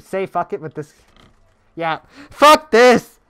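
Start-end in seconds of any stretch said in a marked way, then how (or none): 1.8-3.2 loud, slow, high furious yell; angry shout. Real, not acted. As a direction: blow up at the game. Rage after losing the match.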